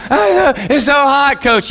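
high, slow complaining whine; high, slow kid's whine to the coach; whiny kid. Retold or imitated. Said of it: "kid complaining to coach".

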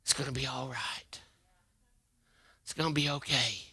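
soft, hushed mock comfort; pat-on-the-shoulder hush; soft mock-soothing comfort; mock consoling. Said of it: "you ever console people?".

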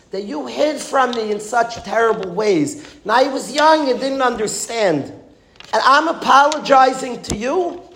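high-pitched plea, not truly screamed; retold impassioned protest. Young man crying out to Hashem over his life, retold impassioned protest. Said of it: "he starts screaming at Hashem".

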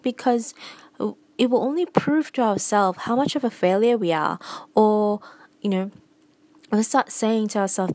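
normal talking voice

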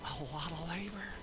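whispered, confiding answer; hushed emphasis. Real, not acted. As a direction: drop to a whisper for the congregation. Hushed answer after loud question.

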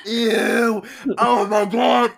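real grossed-out outburst; disgusted shout. Real, not acted. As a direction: blurt it out, grossed out. Disgusted reaction to the story.

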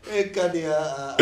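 ordinary delivery

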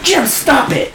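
high giggling reprimand; wife scolding husband through laughter; laughing scold. Retold or imitated. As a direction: tell him off while cracking up. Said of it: "She's like".

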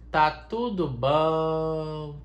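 very slow, stretched mantra; mock meditation. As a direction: draw out "tudo" like a chant. Leads a meditation. (mantra arrastado e lento)

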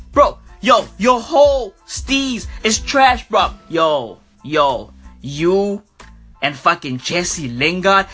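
ordinary talk, nothing marked

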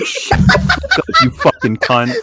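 cursing through laughter; laugh-yelling insults at a friend; laughing swearing tirade. Real, not acted.

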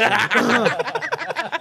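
high, loud burst of laughter. Real, not acted. Host laughs loudly at a friend's mocking remark.

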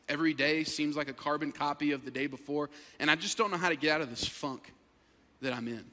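low, soft, downcast admission; subdued confession. Retold or imitated. Struggling man confiding in pastor, low subdued voice. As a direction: voice a man quietly owning up to trouble.

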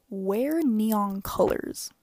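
loud, drawn-out mock-grand reveal; big announcement. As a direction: proclaim it slowly like a grand reveal. Big reveal of her silly idea.

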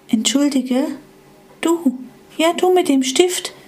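little yellow device asking timidly; small timid device. Acted. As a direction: ask like a shy little gadget. Small Etrex asks shyly.

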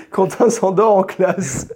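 slow, high, laughing delivery. Real, not acted. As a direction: drag it out through laughter. Laughing while quoting school report.

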